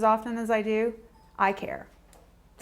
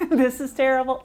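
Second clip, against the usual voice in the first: normal talking voice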